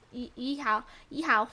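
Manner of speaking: ordinary talk, nothing marked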